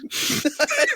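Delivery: squealing laugh; high burst of laughter. Real, not acted. Laughing at friend.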